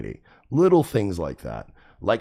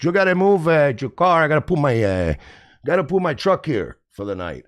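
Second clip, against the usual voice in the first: mock Cuban accent, pitched up; 6 semitones higher, 3 dB louder than usual. Stranger demanding room for his truck, high mock Cuban accent. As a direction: imitate a Cuban guy pushing for the spot. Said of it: "Fucking Cubans".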